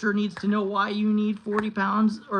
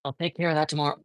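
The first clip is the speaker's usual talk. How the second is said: usual speaking voice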